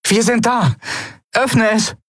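high, urgent call. A narrator's reading. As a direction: call out eagerly to the others. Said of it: "rief Andriel".

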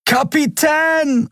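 very high, slow drawn-out cry of Kapitän; calling out. A narrator's reading. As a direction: stretch Kapitän into a long desperate call. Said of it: "rief Miko und sprang". (sehr hoher, langgezogener Ruf Kapitän)

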